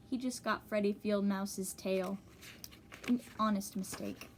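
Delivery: ordinary delivery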